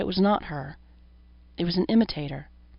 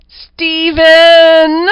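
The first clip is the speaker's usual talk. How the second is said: high, loud, tinny falsetto calling his name; 11 semitones higher, 16 dB louder than usual. A narrator's reading. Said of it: "The strange falsetto had a tinny vibration".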